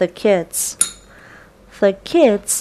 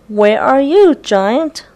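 calling out; 5 semitones higher, 4 dB louder than usual. A narrator's reading. Kids calling for the giant.

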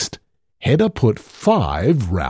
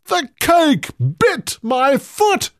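much higher, alarmed cry; panicked giant. A narrator's reading. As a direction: wail it in panic. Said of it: "cried the giant".

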